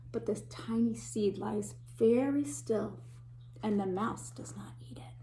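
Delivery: hushed storybook whisper; a secret whisper to the children. Acted. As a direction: whisper it so the mouse won't hear. Hushed moment, seed hiding from mouse.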